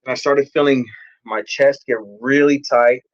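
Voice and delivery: ordinary talk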